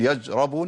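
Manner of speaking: j in place of sh, يجربون; shin turned jim. Shown as a demonstration. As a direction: say يشربون wrongly as يجربون. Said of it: "أنا كده دخلت على مخرج الجيم".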